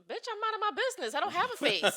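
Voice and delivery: high, loud, fast, laughing comeback; replying to the coworker's complaint; indignant retort. Retold or imitated. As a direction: snap back in mock outrage.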